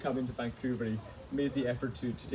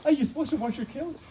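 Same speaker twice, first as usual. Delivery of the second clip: high, laughing question; laughing mock innocence; 13 semitones higher than usual. Real, not acted. Guest who never washed the kilt asks, laughing innocently. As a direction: ask it high through real laughter.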